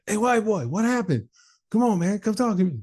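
high, insistent mock call; pestering caller. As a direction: coax him from across the hall, high-pitched. Imitates neighbour calling him again.

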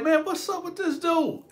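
high-pitched baffled complaint; incredulous griping, pitched well up; retold exasperation. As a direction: exclaim it high, fed up with another driver. Retold annoyance at brake checker.

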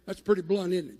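normal talking voice

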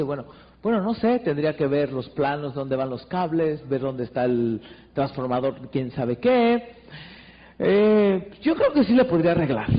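soft, doubtful mumbling workman; a quiet, wavering handyman; hesitant electrician. Acted. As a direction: hedge every word, unsure of yourself. Unsure electrician in acted scene.

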